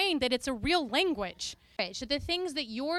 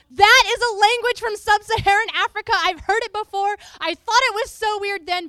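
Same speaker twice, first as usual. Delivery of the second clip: much higher, much louder astonished cry; amazed exclamation; 6 semitones higher, 10 dB louder than usual. Retold or imitated. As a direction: blurt it out amazed. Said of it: "woman who'd been doubting ... goes".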